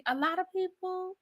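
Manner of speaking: a high, soft, sly afterthought; sarcastic aside. Real, not acted. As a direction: toss it off with a wink. Sarcastic aside about pre-gaming.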